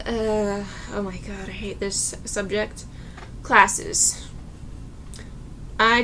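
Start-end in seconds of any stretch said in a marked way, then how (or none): 0.1-2.9 dreading. Real, not acted. Dreading the next topic, groaning.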